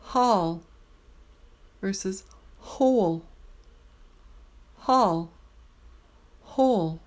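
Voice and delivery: Hall with an open aw, whole with a round oh; aw versus oh. Shown as a demonstration. Teacher contrasting hall and whole. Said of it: "Twenty-four".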